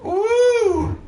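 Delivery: high, drawn-out victory whoop; excited whoop. Real, not acted. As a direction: let out a high celebratory "woo". Celebrates an unexpected win.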